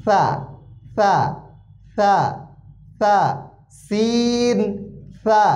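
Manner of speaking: সিন and সা said very thin and light; very thin letter sound. Shown as a demonstration. Teacher shows students the thin letter sound. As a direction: keep সা thin and airy, nothing heavy. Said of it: "একদম পাতলা".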